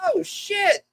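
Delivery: high surprised yelp; high mock-startled oh shit. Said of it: "he's like".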